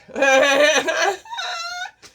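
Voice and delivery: high, loud cackling laugh; long high-pitched laugh. Real, not acted. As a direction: burst into a rising laugh. Laughs at her own remark.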